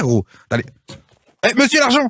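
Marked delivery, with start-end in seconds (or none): 1.5-2.1 high-pitched imitation of a shopkeeper; mock indignant shopkeeper, pitched way up; tobacconist calling out. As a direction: call the customer back for the money. Acts out tobacconist calling him back.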